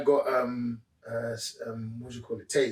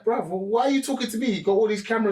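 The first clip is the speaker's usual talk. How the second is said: higher-pitched, mock-indignant questioning; indignant protest; 5 semitones higher, faster than usual. Confronted cheater to the security guy, indignant protest. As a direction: protest like you're being picked on.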